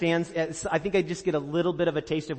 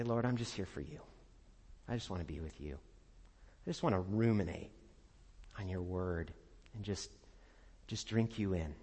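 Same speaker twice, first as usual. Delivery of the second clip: quiet prayer; 8 semitones lower, 9 dB quieter than usual. Retold or imitated.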